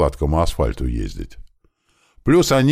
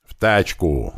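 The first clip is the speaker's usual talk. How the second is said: usual speaking voice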